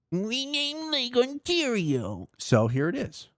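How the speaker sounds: mock the president as a whining baby; mocking crybaby. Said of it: "cry like a diaper-filled bitch baby".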